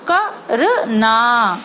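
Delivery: करना drawn out slowly, ना held long; slow sounded-out word. Shown as a demonstration. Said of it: "का का sound हमने slow लिया". (करना को धीरे, ना को लंबा बोलना)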